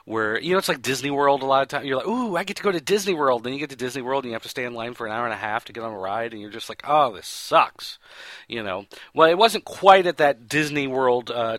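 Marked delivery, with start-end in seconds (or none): 2.1-3.4 higher-pitched eager "ooh"; mock excitement. Eager visitor heading to Disney World, mock excitement. 6.9-8.0 slow, drawn-out complaint; mock disappointment. Visitor stuck in a ride line, mock fed-up groan.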